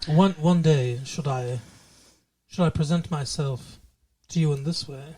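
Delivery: low, soft would-be English seducer; low English-accent courting voice; attempted sexy English accent. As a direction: put on a hushed, low British come-on. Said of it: "that's not a sexy English accent".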